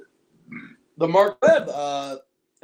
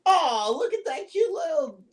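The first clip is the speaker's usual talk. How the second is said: mocking taunt; 8 semitones higher than usual. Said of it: "He's like".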